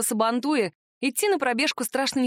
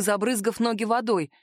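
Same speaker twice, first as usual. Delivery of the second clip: usual speaking voice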